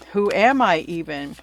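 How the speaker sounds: ordinary delivery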